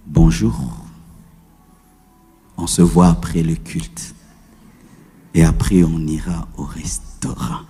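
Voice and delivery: deep cooing lover's tone; tender lover's voice. Shown as a demonstration. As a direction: drop into a soft murmur for your beloved. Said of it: "quand c'est quelqu'un que tu aimes".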